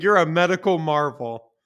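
amused remark. Retold or imitated. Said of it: "She was like".

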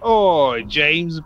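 lightly attempted British accent, loud on the opening word; bad British accent. Villain greeting his prisoner James, in a bad British accent. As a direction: have a clumsy go at sounding British. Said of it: "I'm really bad at British accents".

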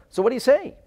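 mock pushy question. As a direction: press him hard for an answer. Acted exchange of wife and husband.

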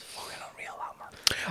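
breathless whisper of disbelief; whispered awe. Real, not acted. Telling a co-host about a cheap festival, in a whisper of awe. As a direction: hush it out, stunned by the bargain. Awed at nine bands cheap.

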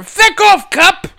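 high, loud furious bark; angry shout. Retold or imitated. Father Jack yelling at the offered tea cup. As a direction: yell it at the cup like a cranky old priest.

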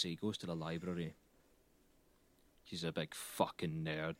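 normal talking voice

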